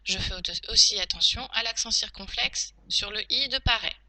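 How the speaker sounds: normal talking voice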